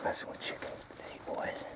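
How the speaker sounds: hushed whisper near the chickens. Real, not acted. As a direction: whisper so you don't spook the birds. Sneaking up on chickens.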